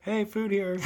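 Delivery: high silly bait voice. Pretend. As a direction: repeat it in a goofy voice. Calling tigers to come eat.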